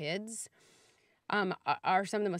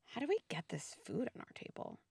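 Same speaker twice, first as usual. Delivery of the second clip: unvoiced, much softer wondering question; whisper; 10 dB quieter than usual. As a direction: ask it softly, almost a secret. Gentle question at dinner table.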